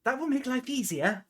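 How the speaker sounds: high-pitched animal companion; companion's voice. Acted. Companion answering the crew's news, high character voice. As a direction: lift it well above narration into a little character voice. Game master voices companion, tagged she says.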